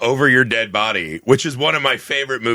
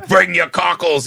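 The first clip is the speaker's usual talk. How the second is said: put-on dirty old sailor voice; 6 semitones higher, 3 dB louder, slower than usual. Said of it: "dirty old sailor voice".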